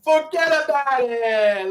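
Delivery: slow drawn forget about it; mock New Yorker toast; hammed-up New York. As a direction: stretch it like a Brooklyn guy.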